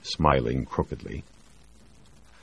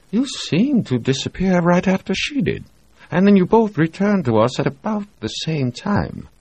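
higher sly insinuating voice; leering doctor; 3 dB louder than usual. Acted. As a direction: needle him with a knowing leer. Reed leering.

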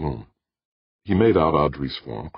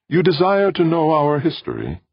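high, loud, stately question; calm tenor machine; 6 dB louder than usual. A narrator's reading. Ancient machine offers its history to visitor, stately tenor. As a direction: ask formally in a high tenor. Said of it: "said a calm, sweet tenor voice".

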